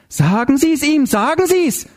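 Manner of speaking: very high, insistent plea; frantic demand. A narrator's reading. Zinzendorfer pressing Karin to speak, frantic demand. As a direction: demand it in a high, desperate cry. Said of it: "forderte die Zinzendorfer".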